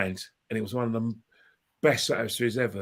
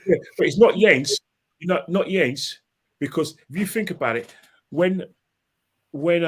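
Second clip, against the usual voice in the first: insists, louder and higher, pushing back; firmly correcting his friend with a raised voice; heated denial; 5 semitones higher, 4 dB louder than usual. Real, not acted. Friend brings up Lienz, he objects.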